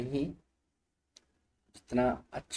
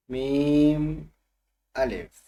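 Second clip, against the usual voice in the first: मीम called out loud and higher, then अलिफ; 3 semitones higher, 7 dB louder, much slower than usual. Shown as a demonstration. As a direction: call out the letters. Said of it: "तो क्या है ये दो हर्फ?". (ज़ोर से मीम अलिफ)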